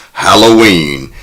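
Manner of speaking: ordinary talk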